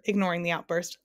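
ordinary delivery, nothing marked

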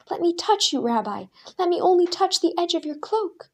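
high, loud desperate begging; desperate plea. A narrator's reading. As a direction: beg him to let you touch. Crowd begging Jesus.

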